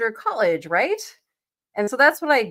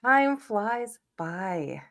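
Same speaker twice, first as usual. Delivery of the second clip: slow, wistful whisper; much slower than usual. Real, not acted. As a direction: breathe it out slowly. Sighing over getting old.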